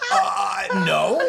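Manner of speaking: loud, high, strained denial; nervous lie. Retold or imitated. Caught man to his boss, a loud panicked denial. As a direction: squeeze out a panicked no.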